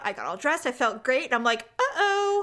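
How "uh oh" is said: high-pitched retold uh-oh; high little note of looming trouble; alarmed uh-oh. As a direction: sing out an oops, up high. Sudden realization retold in story.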